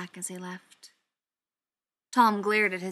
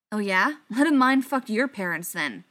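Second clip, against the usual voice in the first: loud sarcastic snap at his friend; angry snap; 9 dB louder than usual. A narrator's reading. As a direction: bite back hard and loud. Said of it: "Spencer snapped".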